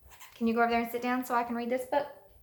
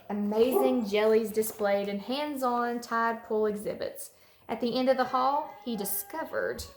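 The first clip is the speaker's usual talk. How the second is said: put-on storybook read-aloud for little kids; reading to children; 3 dB louder than usual. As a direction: read it like story time for a class. Teacher reads picture book aloud to kids.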